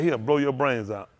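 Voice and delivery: usual speaking voice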